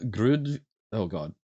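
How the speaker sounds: ordinary talk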